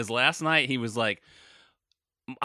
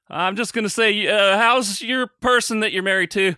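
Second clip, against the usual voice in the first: higher, louder, overly chipper question to cover a forgotten name; fake-friendly, too bright, higher and louder; awkward overeager quote; 8 semitones higher, 7 dB louder than usual. Retelling how he dodges a friend's wife's name, higher and louder.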